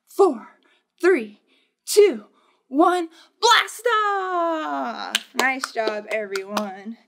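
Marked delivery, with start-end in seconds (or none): 0.1-5.1 louder, higher countdown into a cheer; excited liftoff countdown. Acted. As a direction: launch it with a big shout. Countdown to blast off.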